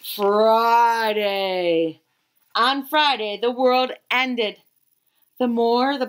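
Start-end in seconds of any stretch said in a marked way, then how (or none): none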